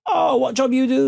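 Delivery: high, chatty Cockney question; mock Cockney masseuse prying; Cockney accent. Imitating the Cockney masseuse asking his job, high Cockney. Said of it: "Cockney twang to her voice".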